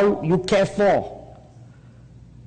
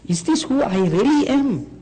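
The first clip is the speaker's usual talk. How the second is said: anguished self-question; 10 semitones higher than usual. Acted. Preacher urging self-examination.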